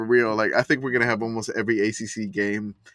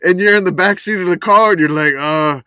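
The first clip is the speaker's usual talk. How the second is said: high, loud pleading; whiny kid in back seat; 9 semitones higher, 7 dB louder than usual. Retold or imitated. Acting out car fight in back seat.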